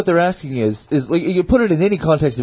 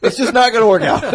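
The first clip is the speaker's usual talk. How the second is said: high-pitched acted inner thought; mock resignation; 7 semitones higher than usual. Dater deciding it is over, mock resignation. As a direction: pitch it up, playing out the doubt. Acting out her thought about dating.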